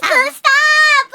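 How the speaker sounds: loud, high pleading cry to stop. Real, not acted. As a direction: yelp for them to stop. Being tickled, crying out.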